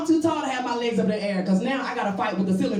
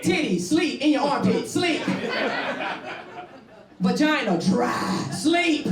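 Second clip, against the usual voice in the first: excited rhythmic list; 5 semitones higher, slower than usual. Acted. Listing body parts going sleep.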